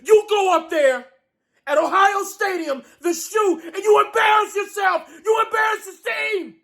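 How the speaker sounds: high, furious yelling at the quarterback; furious shout. Real, not acted. Fan raging at his quarterback, furious high shout. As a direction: scream it out, disgusted and enraged.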